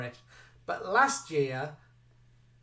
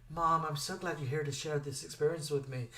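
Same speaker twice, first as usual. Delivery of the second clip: imitating American contestant; 5 dB quieter than usual. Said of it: "said on the U S one, it's things like".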